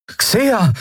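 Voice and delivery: hoch und erschrocken rufen; erschrockener Ruf. A narrator's reading. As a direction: entsetzt den Namen rufen. Miko findet Xea am Boden. (erschrockener Ruf)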